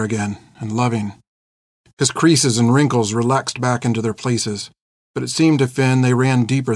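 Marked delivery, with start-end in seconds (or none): none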